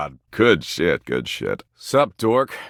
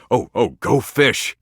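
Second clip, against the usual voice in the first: excited; 5 semitones higher than usual. Acted. Suddenly remembers a favourite game.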